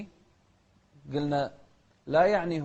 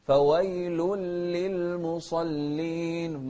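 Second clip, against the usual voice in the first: slow, sung recitation; slow sung Quran recitation; melodic Quran recitation; 2 semitones higher, 3 dB louder, much slower than usual. Shown as a demonstration. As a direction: chant it slowly and melodically.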